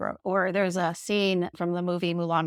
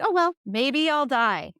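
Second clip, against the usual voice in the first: higher, mock-casual "oh well"; retold resigned shrug; 6 semitones higher, 4 dB louder than usual. Recalling taking too much Xanax, mock-casual shrug. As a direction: toss it off as if indifferent. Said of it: "And that was my attitude".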